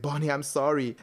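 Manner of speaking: ordinary delivery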